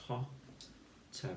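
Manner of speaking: soft unvoiced hotter, split into two beats; whispered in two syllables. Shown as a demonstration. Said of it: "So two syllables".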